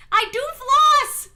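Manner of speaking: high, loud slow protest; indignant shout. Real, not acted. Answering chat's floss joke, high loud protest. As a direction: fire back at the teasing. Defending herself to chat.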